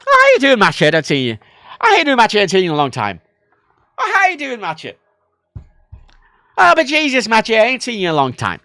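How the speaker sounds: loud, high Irish welcome, sliding down; casual Irish greeting. Retold or imitated. Imitating an Irish pal who hasn't seen him, hearty Irish. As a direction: hail a long-lost friend in an Irish accent. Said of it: "it's casual".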